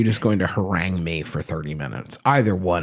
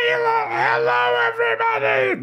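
put-on old podcast voice. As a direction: squeal the hello way up high. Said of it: "how I used to sound when I started podcasting".

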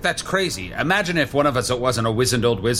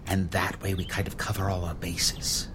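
whispering; 3 semitones lower, 7 dB quieter than usual. Real, not acted. Team huddle aside.

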